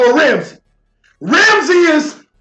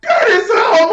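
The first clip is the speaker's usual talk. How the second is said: laughing disbelief. Real, not acted. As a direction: laugh it out, can't believe the comments. Reading absurd comments in disbelief.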